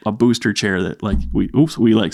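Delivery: usual speaking voice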